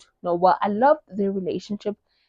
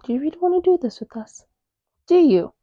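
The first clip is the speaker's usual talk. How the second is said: fast, higher mock taunt at a rival; taunting threat; 7 semitones higher, faster than usual. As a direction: needle them, lightly menacing. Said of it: "they're like".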